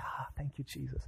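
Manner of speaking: hushed, breathed-out thanks; relieved sigh. Retold or imitated. Man finding pants nearby, hushed relief. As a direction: let it out very softly, in relief.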